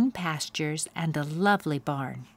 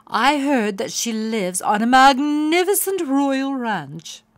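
higher and louder, breathless gossip; gossipy excitement; 6 semitones higher, 8 dB louder than usual. A narrator's reading. Pony gossiping about Princess Penelope, excited. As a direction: share the royal news eagerly. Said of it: "said Bunbun".

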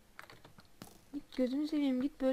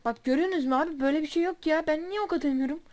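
loud, higher, fed-up shouting to viewers; angry rant; 4 semitones higher, 7 dB louder than usual. Real, not acted. Gamer ranting to his viewers about the enemy, angry. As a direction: vent your anger at the unfair game.

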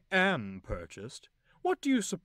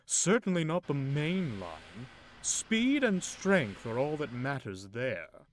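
louder, slow, lofty bragging; arrogant boast; 4 semitones higher, 6 dB louder, slower than usual. Acted. Loaned engine belittling Edward, arrogant. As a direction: lord it over the old engine.

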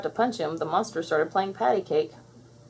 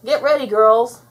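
loud, raised warning call; urgent warning; 5 semitones higher, 9 dB louder than usual. A narrator's reading. Blossom warning her sisters of the monster, urgent. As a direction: warn the team to brace. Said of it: "Blossom warned her sisters".

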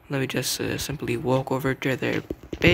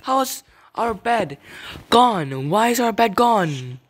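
high, loud incredulous cry; outraged disbelief; 6 semitones higher, 5 dB louder than usual. Real, not acted. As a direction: demand an explanation from your team. Outraged that the bed is lost.

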